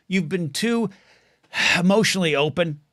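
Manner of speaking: hammed-up heartfelt confession; mock emotional, with a sigh. Telling his friend to hold back, with a theatrical sigh. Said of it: "Gotta get my deep breaths here for this emotional content".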